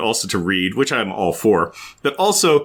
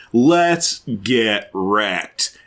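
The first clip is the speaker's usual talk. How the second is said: drawn-out mock party voice; 4 semitones lower, much slower than usual. Mocking the friend's bad advice.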